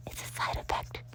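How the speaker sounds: soft secret whisper. Acted. Tucker whispering to Sam over Danny. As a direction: mouth it barely aloud. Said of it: "Tucker mouthed over to Sam".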